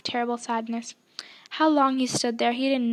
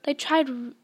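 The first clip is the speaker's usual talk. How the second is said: usual speaking voice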